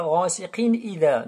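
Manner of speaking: "ghasiqin", then a fresh glottal start on "idha"; wrong unlinked reading. Shown as a demonstration. Teacher shows the mistake, hamza sounded in "idha". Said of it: "On dit pas".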